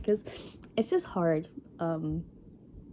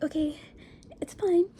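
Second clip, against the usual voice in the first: high-pitched, strained self-soothing; retold self-reassurance; 11 semitones higher, 3 dB louder than usual. To herself after crying in the bathroom, high retold self-reassurance. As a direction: re-enact pulling yourself together, voice pitched way up. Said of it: "I start crying".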